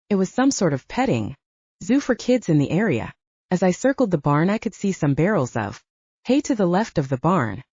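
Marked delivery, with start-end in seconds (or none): none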